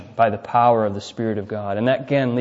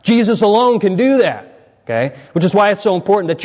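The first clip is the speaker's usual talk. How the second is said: rising, louder, higher preaching; preaching at full force; 5 semitones higher, 3 dB louder than usual. Acted. Preacher stresses faith alone saves, preaching forcefully. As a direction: press the point hard to the congregation.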